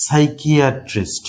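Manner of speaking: সাইকিয়াট্রিস্ট said slowly as "saikiatrist" with no p; drawn-out model of সাইকিয়াট্রিস্ট, p dropped; careful teacher model, silent p; slow silent p demo. Shown as a demonstration. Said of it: "পি কিন্তু silent হয়ে গেছে".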